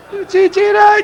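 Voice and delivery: very high, loud astonished cry; amazed shout. Acted. Amazed character calls out.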